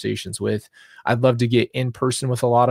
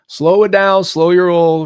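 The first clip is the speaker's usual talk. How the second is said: loud, high call to herself to calm down; self-pep talk; 8 semitones higher, 11 dB louder than usual. Real, not acted. Host fighting tears tells herself to slow down, loud and high. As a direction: rein herself in, louder and higher, half laughing at herself.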